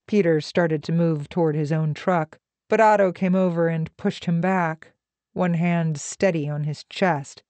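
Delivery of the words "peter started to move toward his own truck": plain reading voice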